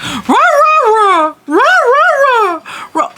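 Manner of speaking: high, loud yappy barks, squeaking up; imitated dog bark. Owner mimics her puppy's bark while telling the story, high yappy bark. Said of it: "he does his bark where it sounds like".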